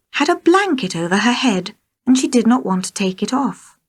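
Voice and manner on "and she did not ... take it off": usual speaking voice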